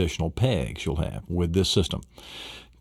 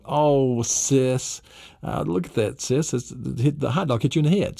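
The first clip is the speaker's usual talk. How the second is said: mock kid voice; 5 semitones higher than usual. Kids imitated in volcano story.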